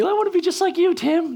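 high-pitched gushing fan imitation; put-on high voice, playful mimicry; mock adoring fan. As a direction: squeal it as a teasing impression of an admirer. Imitating fans, mock quote.

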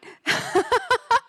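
high peal of laughter. Real, not acted. Host teasing an eager next speaker, laughing.

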